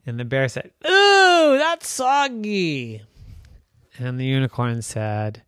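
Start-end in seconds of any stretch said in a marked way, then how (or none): 0.9-3.0 high, slow squeamish ooh; mock disgust. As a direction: squirm at the soggy kiss. Bear reacts to kiss.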